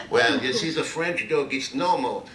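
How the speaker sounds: a hint of a knowing listener teasing; mock audience voice.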